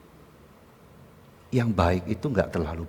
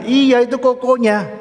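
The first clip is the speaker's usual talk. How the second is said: very high, loud, insistent yes; emphatic confirmation; 11 dB louder than usual. Acted. Wife insisting to the astonished pastor, emphatic confirmation. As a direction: confirm it with exasperated force.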